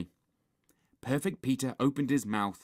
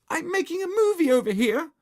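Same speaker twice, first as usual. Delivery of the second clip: high, loud indignant yell; screamed complaint; 8 dB louder than usual. A narrator's reading. Margaret protests at Henry's noise, screaming. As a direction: scream it over the wall. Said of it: "she screamed".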